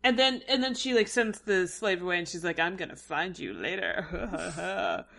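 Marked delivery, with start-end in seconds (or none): 2.6-5.1 slow, through a laugh; laughing sultry tease. Retold or imitated. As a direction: tease it out slowly while laughing. Yara quoted, flirting with slave.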